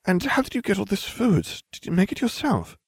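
very high, coaxing voice; sweetly patronizing girl's voice; talking to a child. A narrator's reading. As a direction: ask as if to a small child. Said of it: "as if interacting with a small child".

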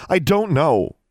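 high, loud, exasperated retort; snapped. A narrator's reading. Girl under questioning retorts to inquisitor, snapped. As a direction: snap back at the questioner. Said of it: "Alexandra snapped".

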